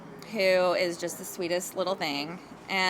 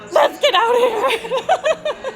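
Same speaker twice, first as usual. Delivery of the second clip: high, slow, half-laughing mock fright; spooked; 13 semitones higher, 6 dB louder, much slower than usual. Realtor fleeing a haunted ballroom with the boys, laughing mock fright. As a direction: draw it out, giggling scared. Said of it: "And we're like".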